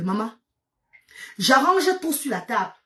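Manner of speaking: ordinary delivery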